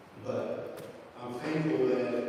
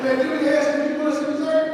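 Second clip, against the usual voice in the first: much higher, louder teasing protest; mock exasperated; 13 semitones higher, 7 dB louder than usual. Friends ribbing Fred for stealing cheesecake, high and loud. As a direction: act out the friends ribbing him.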